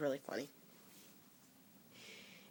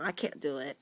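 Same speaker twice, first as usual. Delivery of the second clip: ordinary talk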